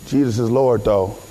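very low, level stoner voice; mock stoner. Preacher plays someone rolling up who still confesses, low stoner voice. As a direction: drop it low as if mid-smoke. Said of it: "In the midst of rolling it up".

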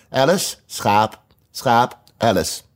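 stately, drawn-out introduction; slow formal introduction. Acted. Red queen introducing Alice to the mutton, slow and stately. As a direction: present each name with slow ceremony.

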